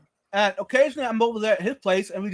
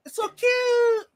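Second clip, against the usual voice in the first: high, slow gushing coo; 14 semitones higher, slower than usual. Real, not acted. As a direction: gush over the puppy. Cooing at the puppy.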